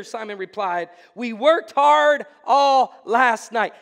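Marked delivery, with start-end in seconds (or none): 1.1-3.7 a tired fisherman pushing back hard; weary exasperated protest. Acted. Simon protests to Jesus after a fruitless night, slow loud exasperation. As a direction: drag it out slow and loud, worn out.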